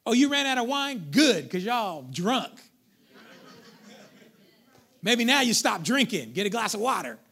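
loud, mock self-righteous scolding; hammed-up church-folk reproach. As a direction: tell off the drunkards, loud and indignant. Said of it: "we would have said, us good Christians".

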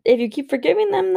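usual speaking voice

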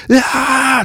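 high, loud mock action-movie yell; action scream. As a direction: scream it like a trailer hero. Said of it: "It's like".

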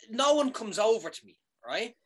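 ordinary delivery

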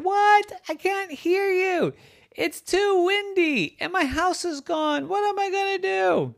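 very high, plaintive wail; panicked townsfolk. Acted. Townspeople losing houses in the gale, high wailing. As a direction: wail it as if over roaring wind.

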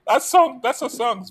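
indignant high protest. Real, not acted. Defending [name] songs, pitch jumps.

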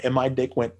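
usual speaking voice

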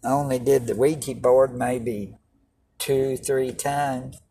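ordinary delivery

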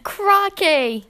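Australian accent. Shown as a demonstration. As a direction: exclaim in a put-on Australian accent. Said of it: "write down the Australian thing".

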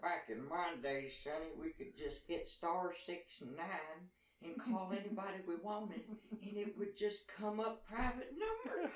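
put-on high, slow, creaky old-timer voice; slow, lilting grandpa lecturing Sonny; very old man. Grandpa voice, "back in my day, Sonny.

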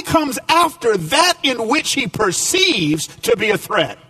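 rising preacher's push, building through each line; preaching. Acted. Pastor preaching to his church, emphatic and driving. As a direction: preach it out to the congregation with conviction.

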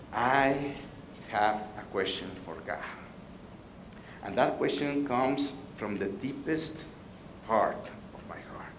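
dropping to a near whisper; hushed, heartfelt confession. Acted. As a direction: lean in and confide it softly, fading to breath. Confessing his deepest question to God.

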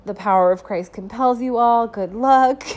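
loud, jokey proclamation rising at the end; hammed-up banishing send-off; mock exorcism. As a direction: call out a playful farewell blessing. Mock send-off to a bad room.

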